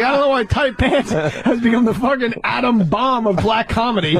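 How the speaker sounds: normal talking voice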